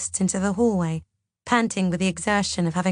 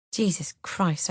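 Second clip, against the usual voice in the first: ordinary delivery, nothing marked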